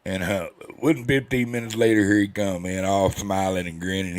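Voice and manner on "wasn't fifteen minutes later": ordinary delivery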